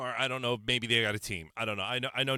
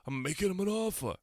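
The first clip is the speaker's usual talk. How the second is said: Godfather quote; 6 semitones higher, faster than usual. Retold or imitated. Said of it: "You're thinking".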